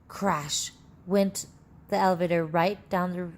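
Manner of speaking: usual speaking voice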